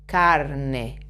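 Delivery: carne with a vibrating R; trilled R. Shown as a demonstration. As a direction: roll the R in carne. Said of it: "vibrate a little bit your tongue".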